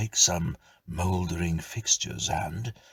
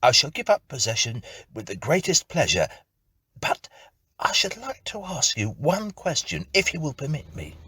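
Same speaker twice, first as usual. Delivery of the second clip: higher, louder, quick courtesy; polite tenant; 9 semitones higher, 4 dB louder than usual. Acted. Tenant bargaining with ghost, high quick politeness. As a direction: rush out polite terms.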